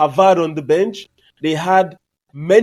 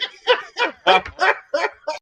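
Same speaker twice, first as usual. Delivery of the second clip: high laugh; 14 semitones higher, 3 dB quieter than usual. Real, not acted. Reacting to the pity quote.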